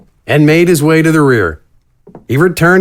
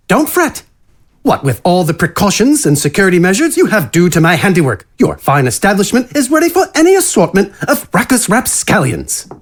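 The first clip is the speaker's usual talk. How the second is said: higher-pitched, genteel character voice; a lightly raised, courtly villain's voice; refined rogue; 6 semitones higher than usual. Acted. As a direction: play the elegant killer, pitched up. Same rogue addresses the innkeeper.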